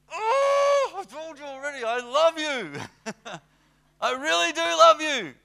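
high, loud, laughing protest; shouted, half-laughing insistence; exasperated Peter. Acted. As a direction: throw up your hands, of course I love you. Peter's third exasperated reply.

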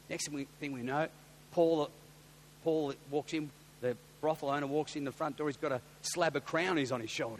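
normal talking voice

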